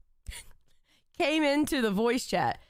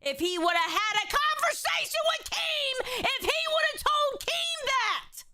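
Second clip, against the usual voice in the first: nearly yelling to be understood; high exasperated shout; 9 semitones higher than usual. Real, not acted. To the co-host, a high exasperated shout. Defending himself against accusation.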